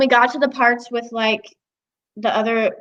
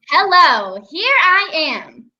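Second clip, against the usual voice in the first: high, drawn-out, projected greeting; broad voiceover arrival line; big, different option; 6 semitones higher, 4 dB louder, slower than usual. Shown as a demonstration. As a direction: start loud, then lift and stretch the ending. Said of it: "giving three different options".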